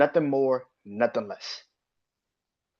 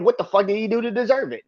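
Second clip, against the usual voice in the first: mocking fans; 9 semitones higher, faster than usual. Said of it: "You were saying".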